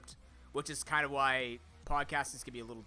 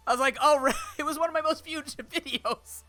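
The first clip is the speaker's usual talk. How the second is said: laughing; 12 semitones higher, 10 dB louder than usual. Real, not acted. Laughing recalling the video.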